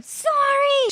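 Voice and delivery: high yelled apology; high yelled sorry. A narrator's reading. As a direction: shout it over the noise. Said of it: "she yelled".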